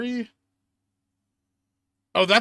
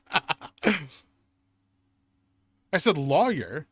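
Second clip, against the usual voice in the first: normal talking voice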